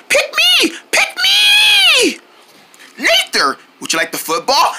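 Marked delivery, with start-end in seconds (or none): 0.1-2.2 high, shouted pleading; eager begging. Acted. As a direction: beg to be picked, bouncing with excitement. Kids begging for the ball.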